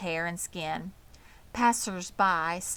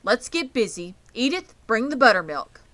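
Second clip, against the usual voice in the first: loud, brisk orders; brisk farmer orders; 4 semitones higher, 7 dB louder than usual. A narrator's reading. Zuckerman orders his wife to fetch buttermilk. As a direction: get everyone moving.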